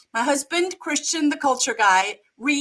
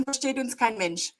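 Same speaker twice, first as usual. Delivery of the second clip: usual speaking voice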